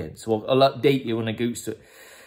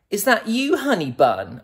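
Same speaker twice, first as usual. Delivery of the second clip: put-on lovey-dovey boyfriend; 10 semitones higher, 3 dB louder than usual. Acted reply to girlfriend's call.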